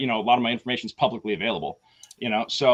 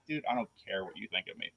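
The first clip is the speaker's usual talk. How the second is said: dismissive brush-off; 5 semitones lower, 13 dB quieter, faster than usual. Retold or imitated. Brushing off the caller.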